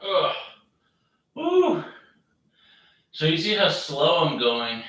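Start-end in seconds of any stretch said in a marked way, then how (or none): 0.0-0.3 strained grunt mid rep; effort grunt. Real, not acted. Grunt during exercise set. 1.3-1.8 high strained woo; high whoop. Real, not acted. Exclaim during hard set.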